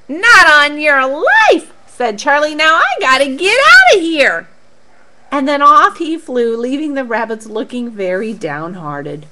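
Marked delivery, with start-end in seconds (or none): 0.1-4.5 Charlie refusing. Acted.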